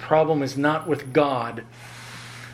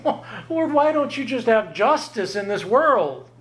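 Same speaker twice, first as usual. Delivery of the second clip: high whiny "Well, Lord"; mock indignant complaint; 8 semitones higher than usual. Preacher mocking sinners demanding justice, high and whiny. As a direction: play the entitled sinner. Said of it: "at that moment saying".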